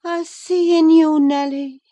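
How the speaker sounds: high, loud, dreamy voice; dreamy Catherine. A narrator's reading. Catherine to Nelly from her sickbed, high and dreamy. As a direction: drift through the words as if half asleep. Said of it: "she continued dreamily".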